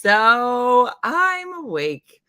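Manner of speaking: slow, loud and high, wired on sugar; sugar-high excited. Real, not acted. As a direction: stretch it out, buzzing from cake. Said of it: "Lucky Charms and cake for breakfast".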